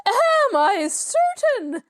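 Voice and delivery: high, slow, stretched indignant cry; screaming. A narrator's reading. Mrs Willoughby to a doubting guest, screaming indignantly. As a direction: fling the question back in outrage. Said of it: "Missus Willoughby screamed".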